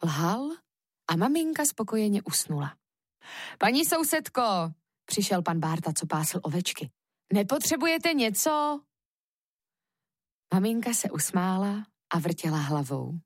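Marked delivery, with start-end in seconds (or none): 3.6-4.4 higher-pitched, friendly neighbour's hail; neighbour calling out. A narrator's reading. Shepherd greeting the woman next door, calling out. 7.3-8.8 higher-pitched, lilting neighbourly question; neighbour offering help. A narrator's reading. Shepherd offering help to Kuba's mother, neighbourly question.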